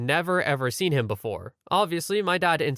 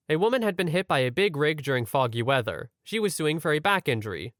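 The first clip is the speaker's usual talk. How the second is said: ordinary talk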